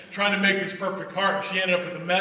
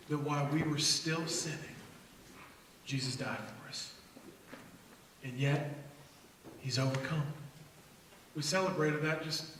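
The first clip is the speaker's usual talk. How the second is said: soft preaching; 4 semitones lower, 3 dB quieter than usual. Acted.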